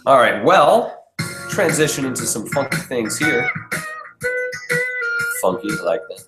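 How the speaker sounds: ordinary talk, nothing marked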